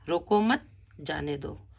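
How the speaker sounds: रोको मत as one phrase, then जाने दो apart; pause after मत. Shown as a demonstration. Teacher repeats what the girl says, pausing after रोको मत. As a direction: keep मत with रोको and break before जाने दो. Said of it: "दूसरे में रोको मत के बाद अल्प विराम है".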